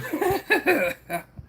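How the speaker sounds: high rippling laugh; amused laugh. Real, not acted. Streamer amused by Bart's barbecue jab, laughing. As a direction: let out a run of high chuckles.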